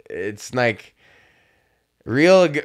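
usual speaking voice

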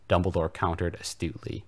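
usual speaking voice

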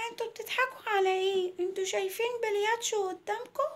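put-on high child voice challenging the laughing kids; indignant little girl. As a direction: protest as a small girl. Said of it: "وهي تقول لهم". (صوت طفلة متضايقة بتعترض)

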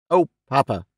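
pointed interjection; pointed significance. A narrator's reading. Daughter breaks in on father, with pointed significance. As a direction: break in meaningfully. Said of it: "broke in with pointed significance".

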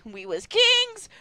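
high, slow proclamation of "We was kings"; slow grand "We was kings"; dramatic "we was kings". Shown as a demonstration. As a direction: declare it like a triumphant ruler. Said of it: "every time she says "was," I wanna say "we was kings".

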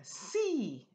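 "Sí" lifted high and drawn out; voice goes up. Shown as a demonstration. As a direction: raise the pitch on a long "Sí". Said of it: "your voice goes up".

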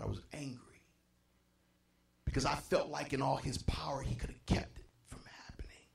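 very soft, low, trailing into a whisper; hushed confession. Real, not acted. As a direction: drop almost to a whisper. Confessing past anger at God.

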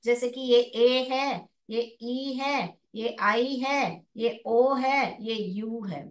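each vowel name ए ई आई ओ यू drawn out slowly; long vowel names. Shown as a demonstration. Teacher listing long vowel sounds slowly. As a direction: stretch every vowel name. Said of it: "वही long sound है".

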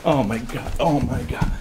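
usual speaking voice

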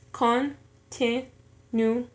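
very slow "continuo", con, ti, nu, o; syllable by syllable. Shown as a demonstration. Learner showing four syllables in an exercise, "continuo" syllable by syllable. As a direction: say "continuo" in four separate pieces. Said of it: "en cuatro sílabas".

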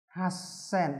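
Hasen as has-sen, with a held s; doubled s in Hasen. Shown as a demonstration. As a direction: softly hold the s in the middle of Hasen. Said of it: "số tám này chúng ta cần chú ý".

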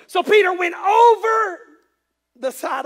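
high, loud, drawn-out narration; dramatic shout. A narrator's reading. Preacher tells Peter climbing out of the boat, dramatic shout. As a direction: belt out the big moment, stretching it.

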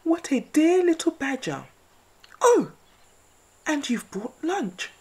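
high, sly owl voice; a sly owl eyeing the mouse. Acted. Owl character voice.

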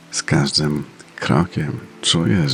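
ordinary talk, nothing marked

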